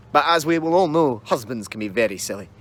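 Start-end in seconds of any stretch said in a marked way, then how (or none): none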